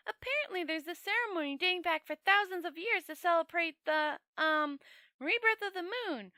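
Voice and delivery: high, eager, wondering tourist voice; a naive visitor gushing over a solemn ritual; Two Flower. Acted. The tourist telling Rincewind about the ceremony, high and eager.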